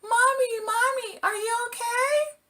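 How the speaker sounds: put-on high child's voice calling out to a hurt mom; little kids worried. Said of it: "they said".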